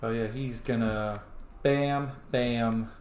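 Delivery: ordinary delivery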